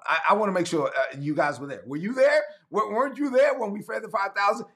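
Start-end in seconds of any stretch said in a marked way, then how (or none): 1.8-4.7 higher, questioning Jesus, pressing them; incredulous Jesus. Retold or imitated. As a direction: press them as an amazed teacher. Jesus questions his disciples.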